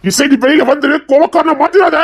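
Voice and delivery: high, loud pleading yell; desperate shouting. Acted. Husband shouting at his wife behind the door. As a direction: yell your hurt at her. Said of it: "நீ எவ்வளவு கத்துனாலும் அவ வரமாட்டா".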